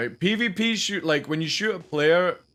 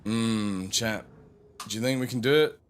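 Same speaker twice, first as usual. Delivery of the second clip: ordinary delivery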